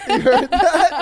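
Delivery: laughing. Real, not acted.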